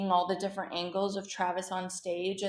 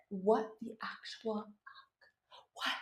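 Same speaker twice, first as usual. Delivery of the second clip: slow, much softer stunned whisper-like; stunned hush; 8 dB quieter, slower than usual. Retold or imitated. Vlogger retelling reply to Rhea, stunned hush. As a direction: breathe it out in disbelief. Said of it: "I said".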